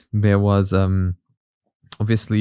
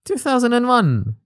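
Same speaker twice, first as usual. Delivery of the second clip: much higher, stunned at the year; surprised; 12 semitones higher than usual. Real, not acted. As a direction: disbelief at the date, pitched way up. Really? surprised at the date.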